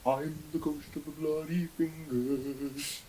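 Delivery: slow, drawn-out moaning ghost; spooky ghost voice. Acted. Ghost announcing itself to the hotel guest, slow and eerie. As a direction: moan it out like a haunting ghost.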